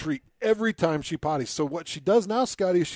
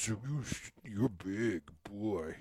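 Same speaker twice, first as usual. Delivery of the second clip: big dog voice; 4 semitones lower, 8 dB quieter, slower than usual. Retold or imitated. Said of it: "every dog has a different voice".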